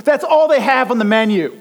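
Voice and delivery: loud, high exasperated outburst. Real, not acted. Telling the sermon crowd about the menu, loud exasperated. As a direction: throw up your hands, loud and high.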